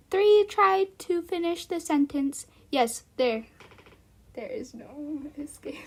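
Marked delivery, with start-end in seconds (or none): 4.3-5.8 soft, sinister whisper; spooky whisper. Pretend. Streamer whispering a mock threat, sinister. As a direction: breathe it like a villain.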